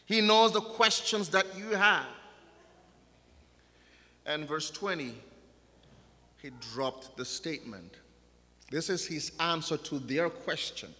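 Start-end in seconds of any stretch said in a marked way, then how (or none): none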